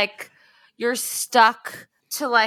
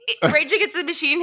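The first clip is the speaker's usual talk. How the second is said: usual speaking voice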